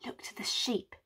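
urgent unvoiced whisper. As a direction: whisper a warning to the others. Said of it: "to each other they whisper".

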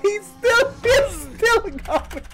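high, slow words squeezed through laughing; real tearful giggling speech; helpless laughter. Real, not acted. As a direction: crack up while pointing it out. Helpless laughing at a zombie still moving.

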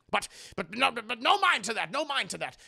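high, loud, stammering dismissal; flustered brush-off. Acted. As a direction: hurriedly wave the subject away. Brushes off grief, changes subject.

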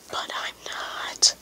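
hushed whisper; whispered aside. Pretend. Player admitting he's not a bird, whispered.

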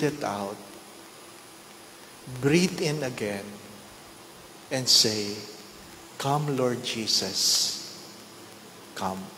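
hushed meditative invitation to pray; guided prayer. Acted. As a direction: guide them into stillness with eyes closed. Leads congregation in closing prayer.

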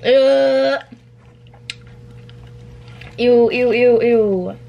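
disgusted. Real, not acted. Disgusted at chewing sounds.

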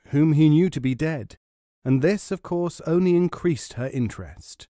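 plain reading voice

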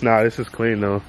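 usual speaking voice

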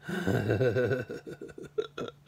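long rippling chuckle. Real, not acted. Storyteller after plugging his baby-singing video, real chuckle. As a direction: laugh to yourself in a run of short pulses.

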